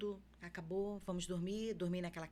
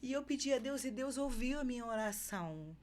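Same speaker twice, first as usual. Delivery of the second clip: ordinary talk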